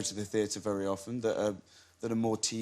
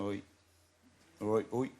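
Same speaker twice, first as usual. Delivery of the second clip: Devon accent; 3 semitones lower, 3 dB quieter than usual. Shown as a demonstration. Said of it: "Could you do some of the accent for us?".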